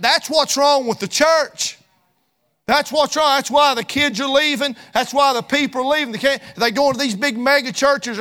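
loud, driving preacher's cadence; fiery preaching. Acted. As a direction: preach it hard to the congregation. Preaching at full force again.

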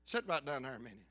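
soft, low and gentle; tender. Retold or imitated. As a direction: comfort her softly. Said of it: "Job tenderly, compassionately".

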